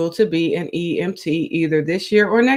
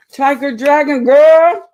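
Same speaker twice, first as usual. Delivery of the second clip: slow, loud, high drawn-out name call; loud announcer-style name call; 6 semitones higher, 7 dB louder, slower than usual. Real, not acted. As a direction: stretch the name out like announcing her. Calling out a viewer's name.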